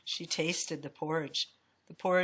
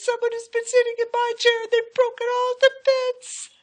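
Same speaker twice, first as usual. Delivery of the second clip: put-on loud, very high baby bear wail; crying wee little voice; 9 dB louder than usual. A narrator's reading. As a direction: wail it like a tiny upset cub. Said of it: "cried Baby Boy in his wee little voice".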